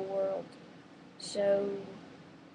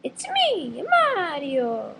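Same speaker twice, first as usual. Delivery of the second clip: high, loud cartoon plumber; Mario; 7 semitones higher, 7 dB louder, much slower than usual. Vlogger trying out game character voices, high Mario voice. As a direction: sing out a high-pitched catchphrase. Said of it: "I'm gonna try the Mario voice".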